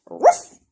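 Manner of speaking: high, loud dog bark. Acted. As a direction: bark it out sharp.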